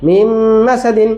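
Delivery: মিম মাসাদিন said slowly, the two words joined with a held nasal m; slow, careful demo of মিম মাসাদিন with a held nasal m; teaching the class to read মিম মাসাদিন as one word; merged pronunciation. Shown as a demonstration. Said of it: "কি উচ্চারণ?".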